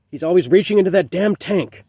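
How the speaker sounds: exasperated mother. A narrator's reading. Mother beside herself after her son's accident, exasperated.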